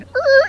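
high squeaky grunt; a sudden high dubbed grunt. Retold or imitated. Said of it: "they kinda dubbed in".

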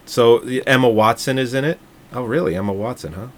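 usual speaking voice

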